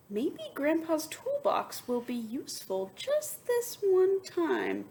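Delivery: a hint of an eager child; boy's thought. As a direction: think it out as the hopeful boy.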